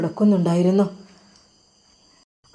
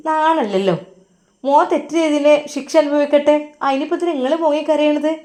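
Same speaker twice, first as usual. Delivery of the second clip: higher, scornful wife's voice; heartless mother; 5 semitones higher than usual. A narrator's reading. Wife mocking her crying husband, higher scornful voice. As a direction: sneer at the weeping husband, pitched up. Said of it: "ഒരു കണ്ണിൽ ചോരയില്ലാത്ത സംസാരമായിരുന്നു".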